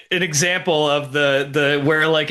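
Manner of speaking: ordinary talk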